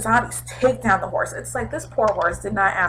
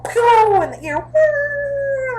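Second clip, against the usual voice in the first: gunshot ringing; much slower than usual. Retold or imitated. Imitating gunshot echo in tank.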